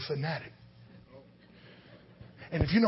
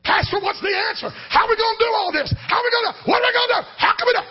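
high, loud pleading cry to the pastor; desperate shout; 10 dB louder, faster than usual. Retold or imitated. Congregation crying out in retold scene.

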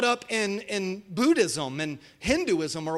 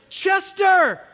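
loud high call of the dog's name; 11 semitones higher, 6 dB louder than usual. Retold or imitated. Kid yelling for his new dog by name. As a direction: shout the dog's name across the yard.

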